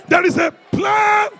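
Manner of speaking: high, loud, drawn-out declaration; defiant preacher's shout; with attitude. Shown as a demonstration. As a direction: shout it with attitude, stretching the words. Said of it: "Say it with some attitude".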